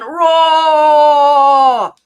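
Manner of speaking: very loud, high, long-held "roar"; lion's roar. Shown as a demonstration. Coach leads the lion's breath exhale, full-force roar. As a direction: bellow it out on one full exhale. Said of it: "I'm gonna say roar".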